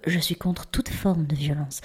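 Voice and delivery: quick whispered aside; mock-serious whispered disclaimer. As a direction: mutter the disclaimer under your breath.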